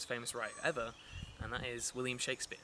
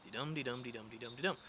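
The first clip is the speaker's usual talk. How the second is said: "Dee-dum" like a heartbeat, "dum" stressed, fading; heartbeat rhythm; faster than usual. Shown as a demonstration. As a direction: thump out "dee-dum" like a pulse. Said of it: "the heartbeat".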